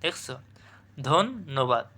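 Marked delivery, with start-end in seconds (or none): 1.1-1.9 ধন্যবাদ broken as "dhon... nobad"; pause inside word. Shown as a demonstration. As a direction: stop after "dhon" in ধন্যবাদ, then "nobad". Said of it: "যেখানে তোমরা থেমে যাবা". (ধন্যবাদে "ধোন্"-এর পরে থেমে "নোবাদ")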